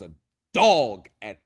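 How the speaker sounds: loud proud boast. Real, not acted. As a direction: blurt the brag out loud. Bragging about criminal law skill.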